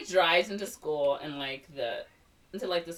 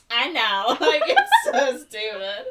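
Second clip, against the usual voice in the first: higher, louder smug reply dissolving into laughter; smug laughing; 11 semitones higher, 10 dB louder, slower than usual. Retold or imitated. Ariel smugly agreeing, high loud laughing. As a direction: purr it then crack up.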